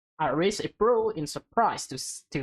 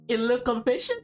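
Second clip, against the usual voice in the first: excited gasp; 13 semitones higher than usual. Real, not acted. Reader reacting to Casey's love confession, high excited squeal.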